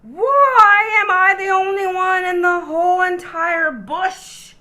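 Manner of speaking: high, loud, fed-up wail; exasperated outcry. Acted. Koala complaining to everyone, high and exasperated. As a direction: throw up your hands and cry it out.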